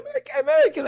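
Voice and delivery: high, strained cry of panic; frantic high-pitched American; panicked plea. Retold or imitated. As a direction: squeak it out in fright at gunpoint. Panicked at gunpoint, pleading identity.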